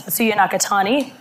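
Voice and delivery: ordinary delivery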